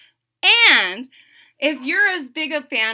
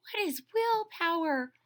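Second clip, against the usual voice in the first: higher, softer puzzled toad; Toad's voice; 8 semitones higher, 5 dB quieter than usual. Acted. Toad asking Frog a puzzled question, higher voice. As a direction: ask like you've never heard the word.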